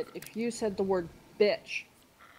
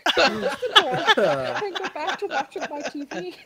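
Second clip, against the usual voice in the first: words breaking up with laughter; giggling too hard to talk straight; laughing; 7 semitones higher, 7 dB louder than usual. Real, not acted. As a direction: laugh through the line. Laughing while retelling.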